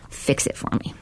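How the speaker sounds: hushed, breathless plea to God; whispered plea. Pretend. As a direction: whisper it like a desperate prayer. Pleading prayer quote to God.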